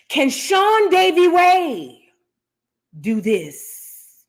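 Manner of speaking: very slow, name held out high; imitated challenge. Host mimics the singer calling out rivals, slow and drawn-out. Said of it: "And she said".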